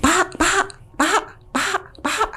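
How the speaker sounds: high call to the floating man. Retold or imitated. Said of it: "Gua sampai teriak".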